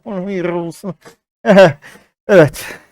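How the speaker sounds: ordinary talk, nothing marked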